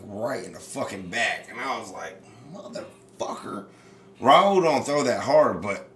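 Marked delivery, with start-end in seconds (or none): 2.5-3.6 breathy muttered curse under the breath, pitched up; a pained curse let out under the breath; whispered curse. Retold or imitated. Hit by the pitch, whispering a curse to himself.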